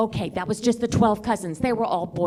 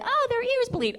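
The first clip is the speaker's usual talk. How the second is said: very high-pitched mimicked mother; mock mom voice; 14 semitones higher, 4 dB quieter than usual. Daughter mimicking what her mom said, high mock voice. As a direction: imitate mom up in falsetto.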